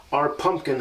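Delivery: ordinary delivery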